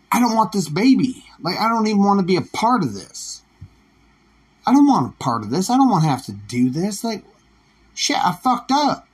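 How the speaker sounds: put-on high, pushy whine refusing the baby; hammed-up dad whining out of it; mock whiny refusal. Reluctant father refusing the baby, high mock whine. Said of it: "But that father's like".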